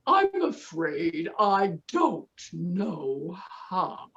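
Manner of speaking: slow, low dopey cow; slow sheepish cow voice. Acted. Cow character speaks.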